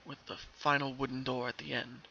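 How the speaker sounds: plain reading voice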